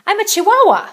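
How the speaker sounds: high, loud proud chuckle; chuckling reply. A narrator's reading. Kitty boy answering the chihuahuas, high and loud. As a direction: declare it with a grin. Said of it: "chuckled the gatito".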